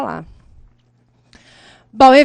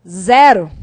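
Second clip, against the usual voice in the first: emphatic verdict; 5 semitones lower, 6 dB louder than usual. Real, not acted. Teacher gives the penalty.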